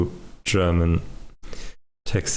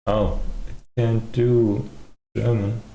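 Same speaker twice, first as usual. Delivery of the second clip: ordinary talk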